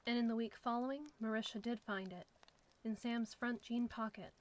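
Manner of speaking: ordinary delivery, nothing marked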